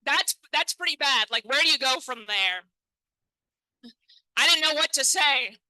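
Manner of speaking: normal talking voice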